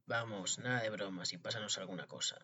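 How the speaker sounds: low, softened storybook murmur; whispering. A narrator's reading. Thieves to Tom Thumb at night, whispering. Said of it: "le cuchichearon".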